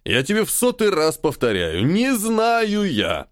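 exasperated, stretching "не знаю я" slow and high; irritated. A narrator's reading. Colonel repeating for the hundredth time, exasperated and slow. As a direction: drag out the denial in exasperation. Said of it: "раздражённо добавив".

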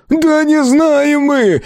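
high, drawn-out exasperated cry; almost yell it in desperation; near shout. A narrator's reading. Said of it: "почти крикнул Валидол". (почти крик, отчаянно и высоко)

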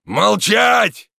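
loud, high commanding roar; thunderous shout. A narrator's reading. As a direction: bellow for silence. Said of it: "громовой голос камнелома".

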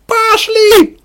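very high, loud shriek; crazed female ringleader's scream; wild squealing cry. A narrator's reading. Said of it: "С привизгом, с дичинкой крик Ксении".

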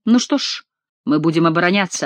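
ordinary delivery, nothing marked

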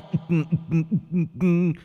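shrill giggle of a ghost; high ghostly laugh. A narrator's reading. Ghost giggles after its song. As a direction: cackle off into the dark. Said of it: "cười ré lên".

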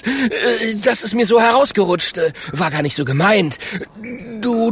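very high, flustered stammer; panicky squirming. Acted. Klonk apologises to the bear, panicky. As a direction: squeal and stumble over the excuse.

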